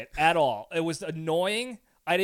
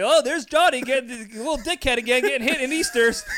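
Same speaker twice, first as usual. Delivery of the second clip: laughing dad; 5 semitones higher, 4 dB louder than usual. Retold or imitated. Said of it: "my father in the background with the camera".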